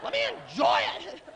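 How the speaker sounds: shrieked falsetto mock fury; very high, hammed-up indignant yell; defiant inner voice. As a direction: screech back at your conscience. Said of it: "two voices that get in an argument".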